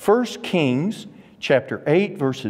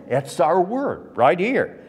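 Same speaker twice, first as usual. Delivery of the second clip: usual speaking voice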